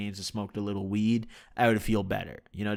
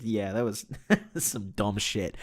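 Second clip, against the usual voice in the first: laughing; slower than usual. Real, not acted. Laughing at his own blunder.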